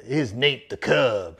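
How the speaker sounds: put-on slow, loose try at Eric Thomas's preacher accent; half-hearted take on Eric Thomas's accent, drawn out slowly; hint of Eric Thomas's hype-preacher accent. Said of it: "I can't do his accent, but he goes".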